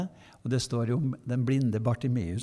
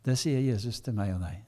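ordinary talk, nothing marked

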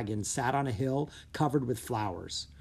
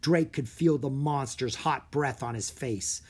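ordinary delivery

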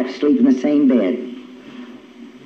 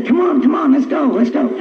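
urgent rousing; 7 semitones higher than usual. Retold or imitated. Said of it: "And I'd say".

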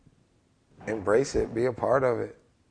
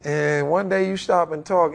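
ordinary talk

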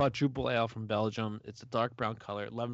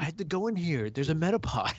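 higher-pitched mock eager gamer; lightly acted excited player; Pokemon gamer character; 5 semitones higher than usual. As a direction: play a gamer chasing a find. Acts a gamer hunting Pokemon in minefield.

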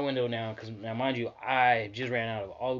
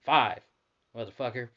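usual speaking voice

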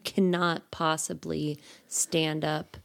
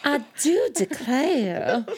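put-on drawn-out Southern belle accent; 7 semitones higher, slower than usual. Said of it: "Southern Belle".

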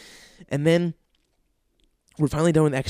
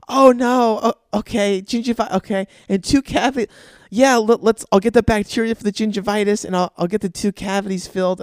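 higher, flustered imitation of the girlfriend; girlfriend's voice; 6 semitones higher than usual. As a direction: mimic her caught out and stammering. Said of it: "I hear my girlfriend's voice go".

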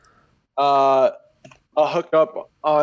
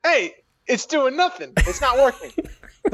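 laughing; 9 semitones higher, slower than usual. Real, not acted. Laughing rant about masks.

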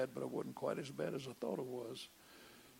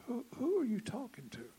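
startled question; 8 semitones higher, 4 dB louder than usual. Retold or imitated. Wife catches him on phone.

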